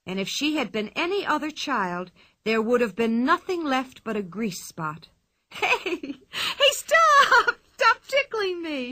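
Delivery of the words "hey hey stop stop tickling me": high squealing shriek through laughter; ticklish shrieking. A narrator's reading. Pippi begging the bull to stop tickling, shrieking and laughing. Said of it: "shrieked Pippi".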